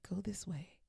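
whispered nudge; hushed, gentle guidance from within; inner voice. Acted. Inner voice guiding the listener, in a whisper. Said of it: "and it said".

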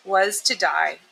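usual speaking voice

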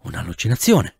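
plain reading voice

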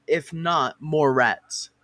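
ordinary talk

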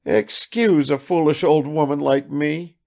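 humble and flustered; mother apologizing to the guest; tearful apology. Acted.

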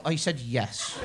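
low, soft mock Galway "I said yes"; a hint of Irish, dropped low; mock Irish flat repeat. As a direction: repeat it patiently in a put-on Galway accent. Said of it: "And you go".

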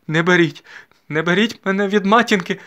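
high, loud pleading; distressed plea. A narrator's reading. Mariana begging not to be taken from mother, distressed. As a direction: beg desperately, voice pushed high. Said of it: "ледве вимовила Мар'яна".

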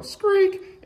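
little bird cry; seagull squeak. A narrator's reading. Seagull noise.

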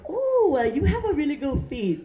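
high, cooing Vietnamese nail lady; Vietnamese accent. Acted. Nail lady complimenting client's feet, high Vietnamese accent. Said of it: "I love their accent".

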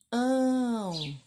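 ão said loud and long; nasal ão modelled. Shown as a demonstration. Teacher introducing ão to kids. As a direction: show the ão sound. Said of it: "Vamos agora acrescentar o".

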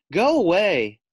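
high, loud shout of go away. Acted. Little possum shoos the fisherman, loud shout. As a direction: chase him off with a yell. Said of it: "the little possum shouted".

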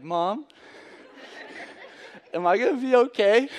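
a mock trembling voice, high and laughing; a shaky, half-laughing ask for reassurance. Son asking his mom if he'll be okay, mock trembling. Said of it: "my voice, like, trembled".

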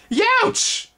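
very high, loud yelp of pain. A narrator's reading. Twig bitten by the insect, high yelp. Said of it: "Twig screamed".

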